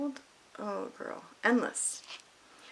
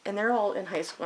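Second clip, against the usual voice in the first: ordinary delivery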